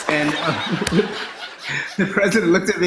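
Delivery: ordinary delivery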